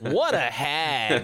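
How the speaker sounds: slow, drawn-out mock character voice; slow laughing quote of a cartoon villain scene; quoting a game line. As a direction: ham up the game's line with a laugh. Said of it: "Maleficent cackling and disappearing".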